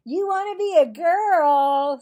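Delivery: very loud, high taunt; sing-song jab at him; teasing girl. Acted. Cassie teasing Marvin, loud and high.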